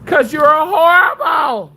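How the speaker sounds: loud, high furious yell; angry shout. Real, not acted. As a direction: tell them off at full volume. Yelling at chat.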